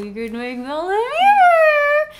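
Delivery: coy suggestion; sly mock hint. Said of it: "[name] says".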